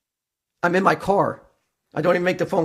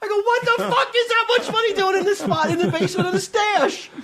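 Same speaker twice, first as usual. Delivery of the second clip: incredulous retort. Retold or imitated. Retelling his reply to Mike.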